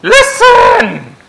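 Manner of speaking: high, loud call of "Look at me! Listen!"; a mother snapping for attention; mother scolding child. Retold or imitated. Mother calling her child to listen, sharp and loud. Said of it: "like a mother would say to a child".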